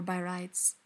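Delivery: ordinary delivery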